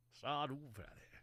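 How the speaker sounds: put-on southern twang; mock southern old-timer accent. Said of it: "like, a southern twang".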